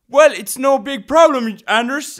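high, loud mock encouragement; loud, slow, high-pitched holler; calling down. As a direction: call from far above, drawn out. Mountain-top friend voiced calling down to him.

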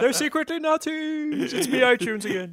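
ghost of iTunes. Acted. ITunes ghost revealing secrets, high spooky wail. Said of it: "I'm the ghost of iTunes".

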